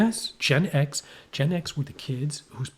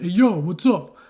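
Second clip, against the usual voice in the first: loud, raised mock-Rocky greeting; brash Rocky imitation; 5 semitones higher, 9 dB louder than usual. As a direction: call out like a kid aping Rocky. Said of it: "now you want to see rock".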